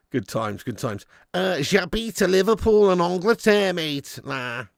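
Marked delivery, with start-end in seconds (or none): 1.6-4.2 higher-pitched Scouse French; put-on Scouser speaking French; Scouse-accented French. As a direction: introduce yourself in French like a Scouser. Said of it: "French in a Scouser's accent".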